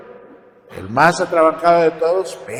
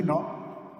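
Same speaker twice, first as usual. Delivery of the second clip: ordinary talk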